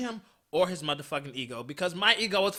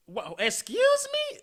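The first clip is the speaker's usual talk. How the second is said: squeaky high disbelief, drawn out; shocked; 3 dB louder than usual. Retold or imitated. Telling the listeners how she reacted, high shocked squeak. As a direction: shoot way up in outrage.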